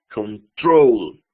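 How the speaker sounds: "Control" con golpe de voz en "trol"; acento final. Shown as a demonstration. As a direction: alargar y recalcar la segunda sílaba de "Control". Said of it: "La segunda". ("Control" didáctico, con "trol" bien marcado)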